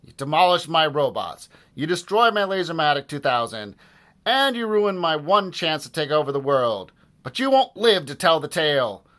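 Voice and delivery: loud, high villain tirade; Doctor Diaper raging. A narrator's reading. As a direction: rant like a thwarted cartoon villain. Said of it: "screamed Doctor Diaper".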